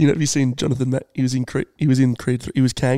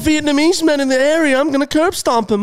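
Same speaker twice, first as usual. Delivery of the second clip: high put-on tough-guy voice; Mark Wahlberg impression; 13 semitones higher than usual. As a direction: play the macho star, pitched up. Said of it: "I'm Mark Wahlberg".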